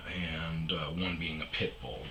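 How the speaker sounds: whispered aside. Real, not acted. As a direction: drop to a hush like a confession. Confessing the pit bull.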